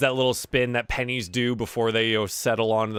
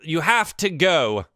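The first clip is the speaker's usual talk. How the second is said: raised, flustered halfling voice; pleading insistence; 5 semitones higher, slower than usual. Acted. Halfling pushing a drunk out, pleading insistently. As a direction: urge them out, pitched up.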